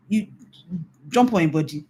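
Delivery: usual speaking voice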